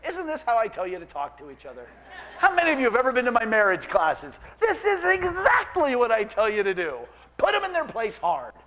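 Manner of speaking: ordinary talk, nothing marked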